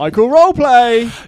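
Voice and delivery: loud announcement. Acted. Announcing the roleplay, slow and loud.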